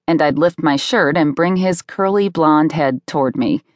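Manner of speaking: usual speaking voice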